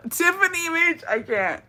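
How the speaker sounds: high burst of laughter into a name; helpless howl of laughter; shrieking laugh. Real, not acted. Reacting to the story.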